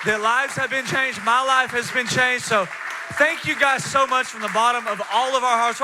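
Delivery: big stage shout-out over applause; rousing preacher. Real, not acted. Preacher thanking the volunteers as the room applauds, rousing.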